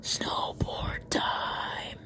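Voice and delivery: slow, drawn-out whisper. Acted. Comic's dream punchline, slow hushed whisper. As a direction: breathe it out slowly without voice.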